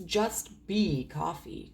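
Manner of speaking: drawn-out, slow delivery; slow reading. Real, not acted. Reader reading out a short line, slow and drawn-out. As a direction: read it out slowly, stretching the words. Slow read, then hushed repeat.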